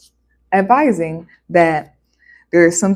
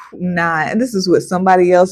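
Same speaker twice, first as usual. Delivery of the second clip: ordinary delivery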